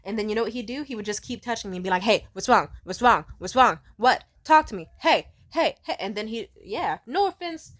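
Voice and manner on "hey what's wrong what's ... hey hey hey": loud, rapid-fire badgering; mock someone insistently demanding attention; each short phrase jabbed out loudly; pestering imitation. Imitates partner's relentless pestering.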